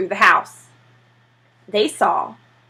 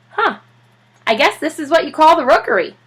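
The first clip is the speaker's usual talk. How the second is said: higher, wry husband voice; musing dad, lightly amused; a dad musing over a penguin's stash; Mister Popper's voice; 6 semitones higher than usual. Acted.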